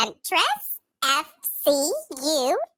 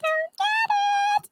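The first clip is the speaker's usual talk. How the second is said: helium voice; 14 semitones higher, faster than usual. Real, not acted. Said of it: "We're so slow in how we talk".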